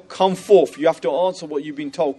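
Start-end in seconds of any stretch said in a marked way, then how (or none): 0.1-0.8 louder, higher summons; commanding call. Retold or imitated. Jibril orders hell to come forth, loud command. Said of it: "It says to hell".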